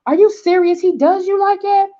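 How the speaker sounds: high, stunned outrage; can't believe what she's hearing; shocked disbelief. Retold or imitated. Friend in the car hearing about the abusive husband, shocked and high. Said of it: "I was like".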